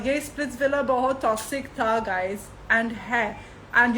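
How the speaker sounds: ordinary talk, nothing marked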